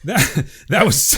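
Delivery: hammed-up British, breaking into a laugh; mock British with a chuckle; bad British accent. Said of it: "this really bad British accent".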